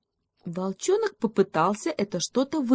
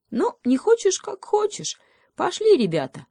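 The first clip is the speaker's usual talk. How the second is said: very high little voice; nonchalant hedgehog; 4 dB louder than usual. A narrator's reading. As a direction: brush it off and call the others away. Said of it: "позвал всех ёжик". (высокий беззаботный голосок ёжика)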